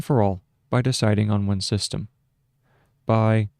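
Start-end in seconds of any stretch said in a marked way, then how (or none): 3.0-3.5 dictating. Shown as a demonstration. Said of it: "so that's period".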